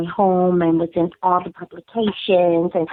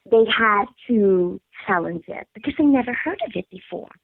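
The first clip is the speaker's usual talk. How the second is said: ordinary talk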